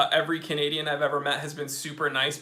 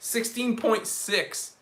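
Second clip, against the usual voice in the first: ordinary delivery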